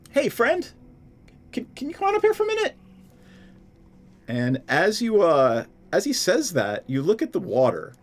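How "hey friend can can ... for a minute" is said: high rushed nervous call. Acted. Javi calls the creature up.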